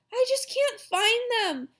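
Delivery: put-on high little-girl whine; upset girl. A narrator's reading. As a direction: complain helplessly in a high voice. Upset Sheila searching.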